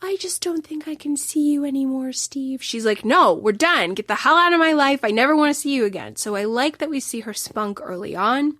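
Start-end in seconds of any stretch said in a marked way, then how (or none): 0.0-2.6 mock-sweet polite breakup voice; a hint of a sweet, apologetic woman letting him down; higher, lightly voiced gentle brush-off; sweet pleasant letdown. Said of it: "She is not a sweet, pleasant". 3.1-6.2 louder, fast angry dismissal; fast furious kiss-off to her lover; defiant and pissy. Retold or imitated. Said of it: "She is defiant. She is pissy".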